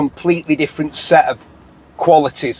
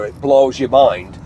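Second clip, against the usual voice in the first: normal talking voice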